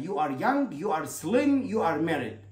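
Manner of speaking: ordinary delivery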